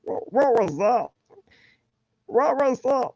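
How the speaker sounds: very high, strained cartoon creature voice; trying for the cartoon meatball's squeal; Meatwad voice. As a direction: squeak it like a blob of meat. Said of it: "that's more like a meat wad".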